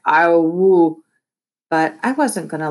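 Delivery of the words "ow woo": garbled words round a whole taco; mouth full. Shown as a demonstration. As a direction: mumble it with your mouth stuffed. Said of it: "it sounded more like".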